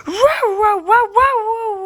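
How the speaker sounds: stretched high wows, slow and loud; high amazed wow. Real, not acted. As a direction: draw out each wow in high awe.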